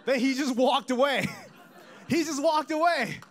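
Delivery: loud, high-pitched disbelief; comic indignation. Acted. Comic outrage at stranger walking off.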